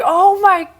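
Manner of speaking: high, loud, drawn-out disbelief; appalled outburst, replayed for the story; retold shock. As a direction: stretch it slowly into a loud high cry. Retold shock at pyro in face.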